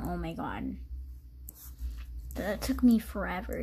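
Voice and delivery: usual speaking voice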